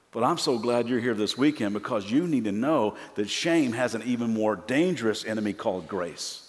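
ordinary delivery

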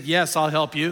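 low-pitched, lightly voiced reassurance; God's voice. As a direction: answer as a deep-voiced God, understated. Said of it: "And he said".

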